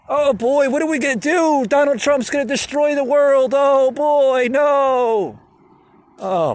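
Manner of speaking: high, loud shrieking panic; mock hysterical Democrat. Mocking the far left during impeachment, high hysterical wail. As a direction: shriek like the world is ending. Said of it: "it's like they".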